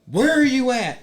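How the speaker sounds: put-on high, loud singsong call for a child. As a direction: imitate a parent calling through the house. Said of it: "I heard Sam go".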